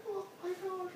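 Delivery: very high, small "oh my gosh"; mock awed gasp. Ezekiel fallen flat before the Lord, high awed squeak. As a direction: squeak it out in acted awe. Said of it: "he was like".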